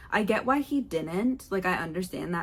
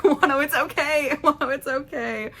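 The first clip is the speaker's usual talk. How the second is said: mock reassuring; 7 semitones higher, 5 dB louder than usual. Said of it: "I'm like".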